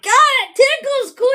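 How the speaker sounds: high, loud squeal breaking into laughter; mock ticklish outcry. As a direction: squeal as if someone's breath tickles your ear. Acting out being tickled by whisper.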